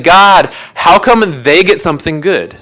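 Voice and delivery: petulant mock protest, why them and not me; jealous complaining to God. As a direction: complain like someone cheated of a reward. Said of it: "we're like".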